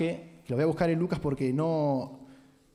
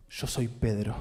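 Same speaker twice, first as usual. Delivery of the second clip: mock Peter voice; 5 semitones lower, slower than usual. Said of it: "dijo Je-, eh, Pedro".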